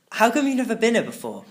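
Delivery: high, loud surprised question; a little surprised. Acted. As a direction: ask it in sudden surprise. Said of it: "a little surprised".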